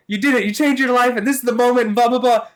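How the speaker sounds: high, loud squeaky cartoon voice. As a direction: shout it like a frantic cartoon kid. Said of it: "And then Cartman's like".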